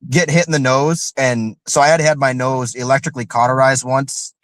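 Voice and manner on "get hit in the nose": usual speaking voice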